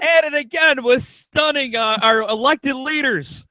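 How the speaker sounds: high announcer call; wrestling announcer. Pretend. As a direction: call it like ringside commentary.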